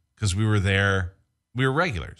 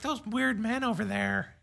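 put-on snooty girl voice; 13 semitones higher, 7 dB quieter than usual. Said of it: "I think she was like".

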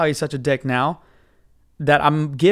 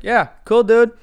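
louder, higher, pointedly dismissive; asshole-ish; 7 semitones higher, 6 dB louder, slower than usual. Retold or imitated. Passenger making sure the driver catches on, openly dickish. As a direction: lay the fake approval on thick, like an asshole. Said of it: "be kind of an asshole about this".

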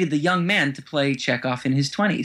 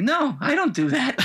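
much higher, mock-protesting imitation; indignant denial; 11 semitones higher than usual. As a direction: act out a huffy, high-pitched denial. Acted indignant denial by Walter.

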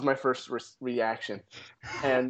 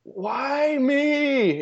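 high, loud, wailing cry of self-pity; mock the old man's weeping wail; 11 semitones higher, 4 dB louder than usual. Retelling the crying old man on the road, high wail. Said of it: "he started crying".

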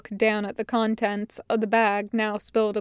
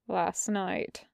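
soft, reluctant murmur; mumbled; 2 semitones lower, 8 dB quieter than usual. A narrator's reading. Wash inspects the wound as he answers, mumbling. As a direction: drop it quietly, almost to yourself. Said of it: "Wash mumbles".

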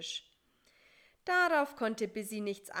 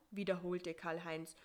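plain reading voice

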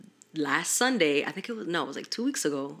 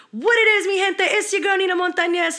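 much higher, louder announcer-style intro; podcast intro; 12 semitones higher, 5 dB louder than usual. Retold or imitated. Nina opening her podcast, high bright host intro. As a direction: sell it like a show opener. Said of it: "my intro for my podcast is".

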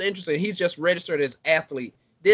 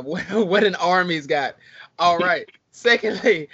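usual speaking voice

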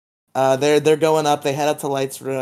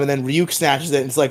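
ordinary talk, nothing marked

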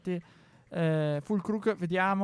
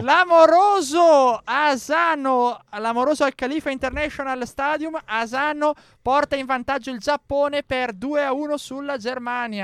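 goal call shout; 6 semitones higher, 9 dB louder than usual. Real, not acted. Goal commentary.

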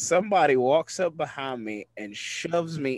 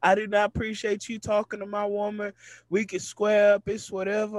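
higher-pitched retelling of an upset challenger; mock upset; 5 semitones higher than usual. Upset woman confronting him about her girlfriend, mock indignant. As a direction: imitate her indignation without shouting. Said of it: "She is highly upset".

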